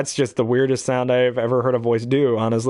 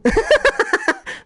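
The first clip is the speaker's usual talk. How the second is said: very high cartoon cackle; rapid squeaky giggle; minion laugh; 4 dB louder than usual. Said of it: "hate the minions just 'cause of their laugh".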